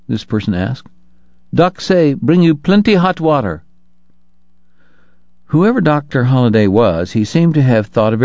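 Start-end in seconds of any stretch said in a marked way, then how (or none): 1.5-3.6 higher-pitched Chinese laundryman, broken English. Acted. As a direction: pitch it up as a Chinese bathhouse man. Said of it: "middle-aged Chinese carrying a large tin bathtub".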